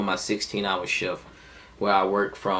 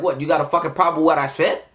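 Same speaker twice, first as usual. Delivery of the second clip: quick, higher-pitched challenge; confrontational retort; 7 semitones higher, 3 dB louder, faster than usual. Retold or imitated. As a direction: act out squaring up to someone. Retelling confronting the boss outside.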